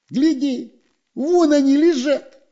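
high, delighted squeal; excited. A narrator's reading. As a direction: spot the prize and squeal. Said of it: "обрадовался Дырка".

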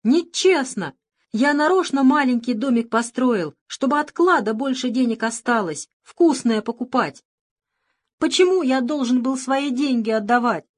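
loud whiny shout. Acted. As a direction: yell and complain like a spoiled boy. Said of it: "кричал".

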